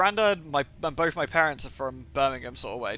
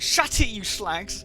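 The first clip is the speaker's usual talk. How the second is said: shut it; 5 semitones higher, 3 dB louder, much slower than usual. Retold or imitated. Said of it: "It's like".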